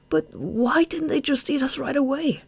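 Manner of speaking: high, distressed asking; bewildered question. A narrator's reading. Shade asks Marina why they were spared, bewildered.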